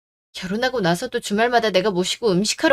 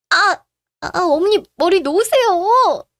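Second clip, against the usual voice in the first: high, loud yelp of pain, drawn out; pained cry; 9 semitones higher, 5 dB louder, slower than usual. Acted. Daughter-in-law with her hair grabbed by mother-in-law, crying out in pain. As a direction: plead loudly through the pain.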